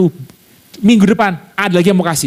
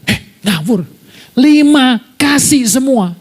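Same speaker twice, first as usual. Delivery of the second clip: mother's voice; 8 semitones higher than usual. Retold or imitated. Friend's mother gives away puppies.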